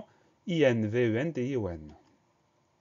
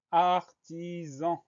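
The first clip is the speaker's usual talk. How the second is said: very slow, stretched syllables, raised pitch; drawn-out dictation word; 6 semitones higher, much slower than usual. Shown as a demonstration. Teacher presenting a new spelling word to pupils, drawn out. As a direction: say it slowly for learners to write.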